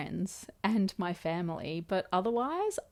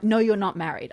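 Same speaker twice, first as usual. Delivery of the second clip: mock argumentative retort, louder; louder, insistent retold denial; retold arguing; 3 semitones higher, 6 dB louder, faster than usual. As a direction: imitate someone contradicting you loudly. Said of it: "that they were arguing about".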